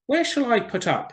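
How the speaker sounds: swallow's voice. Acted. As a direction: voice the swallow up high.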